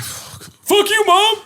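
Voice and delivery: very high, drawn-out squeal at mom; hammed-up squeaky outburst; mock defiant son. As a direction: play a kid cursing mom in falsetto. Acting out Vince defying his mom.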